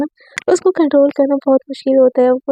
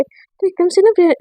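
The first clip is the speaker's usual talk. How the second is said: ordinary delivery, nothing marked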